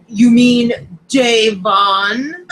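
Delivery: slow, loud mock questioning; Will Hughes. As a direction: imitate a host, drawing the words out loudly. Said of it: "You sound just like Will Hughes".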